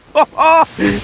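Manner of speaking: gleeful laugh of discovery; triumphant laugh. Acted. Itto spotting a pile of beetles, triumphant laugh. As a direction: laugh like you struck gold.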